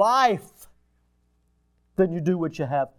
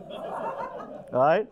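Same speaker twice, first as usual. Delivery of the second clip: laughing; 3 semitones lower, 6 dB quieter than usual. Real, not acted. Laughing at his own story.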